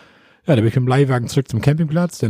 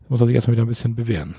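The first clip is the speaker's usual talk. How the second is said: ordinary talk, nothing marked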